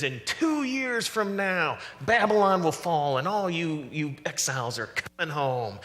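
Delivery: hammed-up prophetic announcement; false prophet. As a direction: declare it grandly like a prophet promising good news. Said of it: "there's this false prophet".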